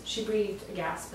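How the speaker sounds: ordinary delivery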